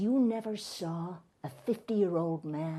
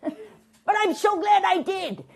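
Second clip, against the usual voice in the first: high, slow, rapturous delivery; gleeful revelation; 14 semitones higher, 3 dB louder than usual. Acted. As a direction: gush over it, drawing the words out. Guest gushes over his discovery.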